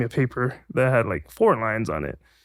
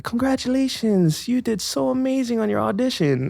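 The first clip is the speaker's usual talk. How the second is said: gushing congratulations; 11 semitones higher than usual. Retold or imitated. Agency lady congratulating a kid after an audition, high mock gushing. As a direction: imitate an over-sweet, high congratulator.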